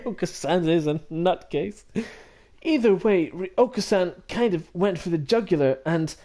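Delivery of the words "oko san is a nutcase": repeating it through a laugh; amused echo. Real, not acted. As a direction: laugh as you echo the phrase.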